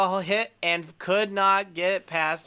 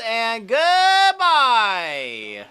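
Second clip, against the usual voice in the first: much higher and louder, slow and drawn out; home run call; 7 semitones higher, 7 dB louder, much slower than usual. Real, not acted. Gamer calls the ball leaving the park, loud and drawn out. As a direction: stretch the goodbye like a TV announcer.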